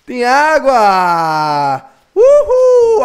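slow drawn-out joyful shout, then whoop; excited. Acted. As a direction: cheer like you struck gold. Finding water at last.

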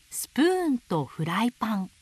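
ordinary delivery, nothing marked

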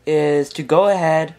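ordinary talk

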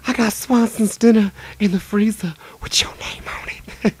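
creepy high whisper; whispered quote. Retold or imitated. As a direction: breathe it out like a stalker. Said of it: "Jimmy Fallon".

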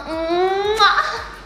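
playful kiss to mum; blown kiss. Pretend.

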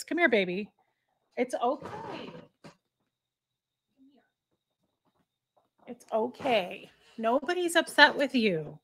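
soothing the dog. Real, not acted. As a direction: murmur it gently to calm her down. Soothing her upset dog.

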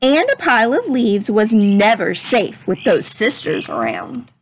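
gentle, measured storybook read-aloud; unhurried classroom story reading voice; reading to children. Acted. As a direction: read it like a picture book to a class. Teacher reads picture book aloud.

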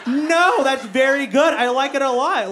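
high, over-polite fake enthusiasm; forced politeness. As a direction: pretend to like an unwanted gift. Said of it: "she was too nice to tell me".

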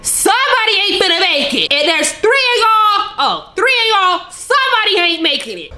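high, excited cry of alarm; alarmed reaction. Real, not acted.

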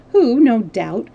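ordinary delivery

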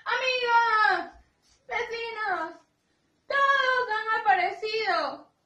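high, loud proclamation with drawn-out words; loud shout. A narrator's reading. Angel calling the neighbours to the risen Jesus, shouting loudly. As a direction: call out to a whole crowd. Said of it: "Y dijo gritando fuerte".

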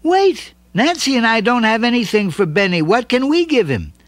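put-on high child's voice, urgent; eager child calling out. A narrator's reading. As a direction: kid calling after friends. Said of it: "Stevie called them back".

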